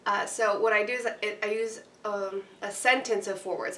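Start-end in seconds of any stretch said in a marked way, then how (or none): none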